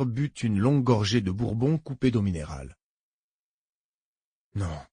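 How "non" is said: a low, barely heard no; very quiet. A narrator's reading. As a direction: say it so softly they lean in. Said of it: "d'une voix si basse que Harrod dut se pencher".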